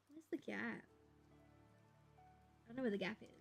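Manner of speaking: normal talking voice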